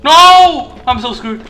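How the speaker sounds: high, loud cry of dismay; yell, then wail in alarm. Real, not acted. Player realises they are doomed, dismayed yell. Caught in game.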